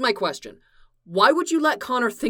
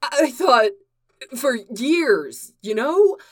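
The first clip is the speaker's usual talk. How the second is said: emotional confession; 4 semitones higher, 4 dB louder than usual. Acted.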